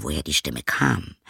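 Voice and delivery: usual speaking voice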